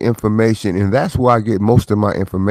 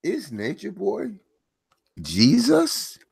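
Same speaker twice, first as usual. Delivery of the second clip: much higher, softer disbelieving question; incredulous; 10 semitones higher, 9 dB quieter than usual. Real, not acted. Host asking viewers about Nature Boy, in high disbelief. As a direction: ask it as if it can't be true.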